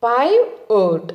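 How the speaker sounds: "పైవోట్" very slowly as PIE... VOTE; slow teacher's sounding-out of "పైవోట్"; drawn-out model pronunciation. Shown as a demonstration. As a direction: stretch "పైవోట్" into two separate beats, pie and vote. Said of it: "ఎలా పలకాలి".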